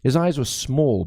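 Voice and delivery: usual speaking voice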